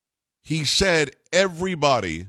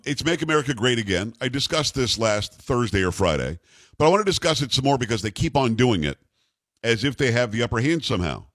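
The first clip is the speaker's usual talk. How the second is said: ordinary talk, nothing marked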